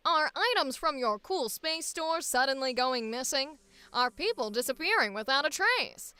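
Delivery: brisk transatlantic newsreel announcer; 1950s corporate safety film narrator; old-timey announcer. Acted. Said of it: "the weird old-timey voices".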